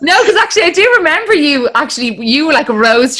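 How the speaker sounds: very high and loud, laughter in it; gushing excitement. Real, not acted. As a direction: burst out delightedly with the memory. Gushing over compliment, laughing.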